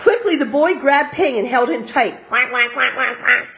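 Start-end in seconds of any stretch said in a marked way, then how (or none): 2.3-3.6 duck call. Acted. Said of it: "cried Ping".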